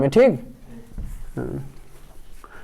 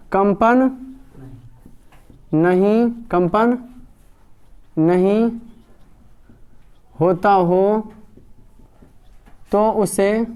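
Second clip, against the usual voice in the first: slow word-by-word dictation with long pauses; teacher dictating notes, slow and measured; slower than usual. Acted. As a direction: give each word time so the class can write it down. Dictates definition slowly for copying.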